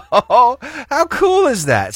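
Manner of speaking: high, slow, laughing exclamation; laughing delight. Real, not acted. Host delighting in the listener's story, laughing. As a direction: let the wonder spill out in a laugh.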